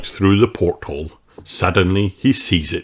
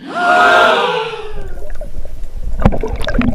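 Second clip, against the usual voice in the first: long, very high gasp; gasp of fright. Acted. Crew gasps at Big Bad Barry. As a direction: gasp in alarm. Children see big fish.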